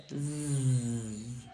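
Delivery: long slow "zzz" through clenched teeth; z buzz. Shown as a demonstration. Teacher showing the vibrating "zzz". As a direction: hold out a buzzing "zzz". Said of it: "you're kind of clenching your teeth".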